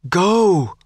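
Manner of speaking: high, restrained narrated scream; screamed order. A narrator's reading. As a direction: scream at him to leave. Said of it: "Gwyn screamed".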